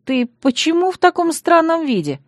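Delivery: high-pitched, wondering question; puzzled question. A narrator's reading. Bride questions Yasha about his odd outfit, puzzled. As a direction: ask in bright-eyed puzzlement. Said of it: "спросила".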